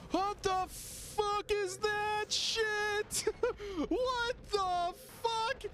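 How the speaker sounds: high, very slow drawn-out shock; high-pitched incredulous wail; stunned disbelief. Real, not acted. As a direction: stretch every word high in amazement, breaking into laughter. Shocked by thing in game.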